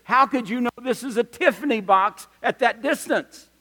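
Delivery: shouted question to someone far off; retold yell back. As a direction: holler back in disbelief, retelling it. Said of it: "I yelled back and said".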